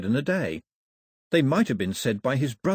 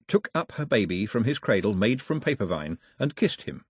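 ordinary delivery

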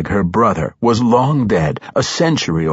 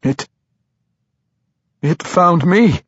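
high, halting, fearful stammer; stumble, then blurt it in dread; 10 semitones higher than usual. A narrator's reading. Tosin sure he is about to die horribly, stammering. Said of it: "Tosin stammered".

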